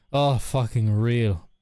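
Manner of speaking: slow, loud, stretched "fucking real"; drawled heartfelt relatable groan; drawn-out emphatic agreement. Real, not acted. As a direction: lean hard into the agreement.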